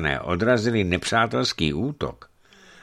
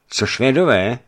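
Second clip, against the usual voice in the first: higher, louder impatient interjection; 7 semitones higher, 7 dB louder than usual. A narrator's reading. Archbishop breaking into the envoy's report, impatient. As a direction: cut in impatiently. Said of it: "přerušil jej netrpělivě vladyka".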